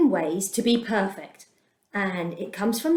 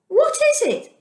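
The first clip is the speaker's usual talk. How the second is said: high, puzzled question; bewildered people staring at the strange food; puzzled asking; 13 semitones higher, 4 dB louder than usual. Acted. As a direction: wonder aloud what it is, voice jumping up. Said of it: "they asked each other".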